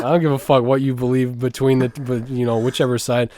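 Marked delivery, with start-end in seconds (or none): none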